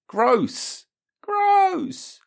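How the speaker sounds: high, loud squealed gross; disgusted squeal. Acted. Picturing a coffee-scented butthole, he squeals high in disgust. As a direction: recoil with a shrieking gross.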